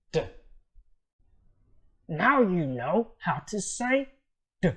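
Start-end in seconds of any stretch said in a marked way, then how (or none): none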